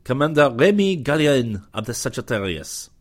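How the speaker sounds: French commander proclaiming his name. A narrator's reading. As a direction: announce yourself like a courtly Frenchman. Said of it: "he proclaimed".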